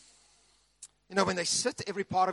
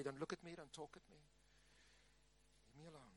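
a much softer, sinking grumble; grumpy morning warning; 4 semitones lower, 26 dB quieter, much faster than usual. Retold or imitated. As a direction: keep them away, barely audible. Grumpy morning without coffee.

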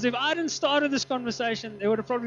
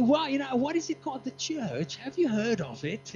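put-on British accent; 4 semitones higher than usual. Preacher replays the plane exchange, mock British accent. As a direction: imitate a British exchange with relish. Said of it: "I love the accent".

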